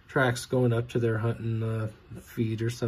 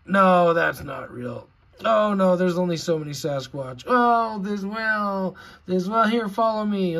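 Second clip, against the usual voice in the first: louder, higher mock dismissive expert; mock gatekeeper; 6 semitones higher, 7 dB louder than usual. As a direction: sneer it like a smug gatekeeper. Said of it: "and then they".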